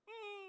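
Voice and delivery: high-pitched fake sob; mock crying hum. Weeping woman before being asked her problem, mock crying. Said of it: "આ બહેનોનો સૂર છે રડવાનો".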